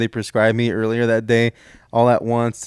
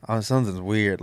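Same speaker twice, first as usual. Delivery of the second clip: quiet uneasy aside; 3 semitones lower, 6 dB quieter than usual. Retold or imitated. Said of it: "It's more like".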